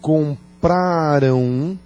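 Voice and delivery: compraram stretched very slowly before na; slow demo. Shown as a demonstration. Teacher slowly building the example for students. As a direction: drag out compraram, then na. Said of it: "Então eu vou dizer".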